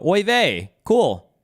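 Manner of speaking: high, loud, breezy oy vey. Retold or imitated. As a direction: toss it off like nobody cares. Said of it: "they would go".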